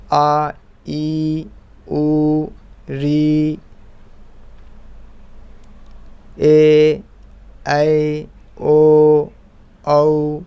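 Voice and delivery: ए and ओ held long and called out loud; teacher reciting long vowels आ ई ए ओ, each held. Shown as a demonstration. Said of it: "तो ये दीर्घ स्वर है".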